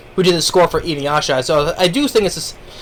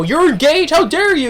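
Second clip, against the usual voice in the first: outraged; 10 semitones higher than usual. Retold or imitated. Rival outraged at engagement.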